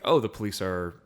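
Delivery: usual speaking voice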